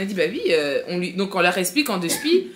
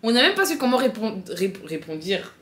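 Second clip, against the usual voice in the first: ordinary talk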